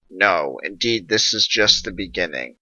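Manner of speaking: ordinary delivery, nothing marked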